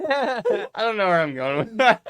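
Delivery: embarrassed laugh while talking. Real, not acted. Laughs at own rambling.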